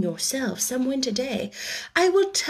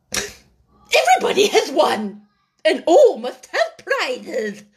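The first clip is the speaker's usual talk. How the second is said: loud, slow, grand proclamation; Dodo's verdict; 6 semitones higher, 4 dB louder, slower than usual. A narrator's reading. The Dodo declares the winners, grand and slow. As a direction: proclaim it triumphantly. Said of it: "At last the Dodo said".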